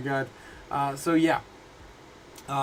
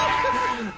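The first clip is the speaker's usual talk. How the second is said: high squealing laugh. Real, not acted. After yelling at Jake.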